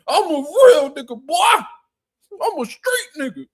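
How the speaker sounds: squealing mock gangster threat; mock tough-guy yell. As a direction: shriek it like a fake hard man. Tough guy facing his brother.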